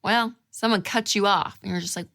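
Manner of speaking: normal talking voice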